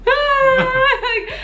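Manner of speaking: high, loud mock sob "Ah!"; retold crying wail. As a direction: burst out in a high teary wail. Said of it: "I'm like".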